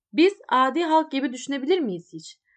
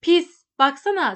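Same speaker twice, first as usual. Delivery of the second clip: loud, contemptuous outburst; furious shout; 4 semitones higher, 8 dB louder than usual. A narrator's reading. Mother shouts at the little seller, furious yell. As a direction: spit the insult out loudly. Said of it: "Sonra haykırdı".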